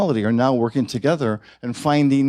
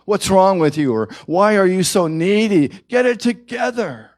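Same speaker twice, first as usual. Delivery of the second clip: louder, higher, scolding and exasperated; voicing the cruel inner critic, loud and scornful; harsh self-critic; 7 semitones higher, 4 dB louder than usual. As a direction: snap at yourself like a fed-up critic.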